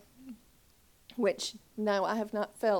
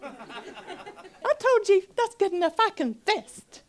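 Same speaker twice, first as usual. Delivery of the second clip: high, slow, laughing plea; laughing protest; 10 semitones higher, 5 dB louder, slower than usual. Retold or imitated. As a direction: laugh it off, begging. Laughing refusal.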